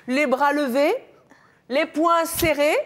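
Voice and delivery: King Kong pose. Shown as a demonstration. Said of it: "il faut imaginer King Kong".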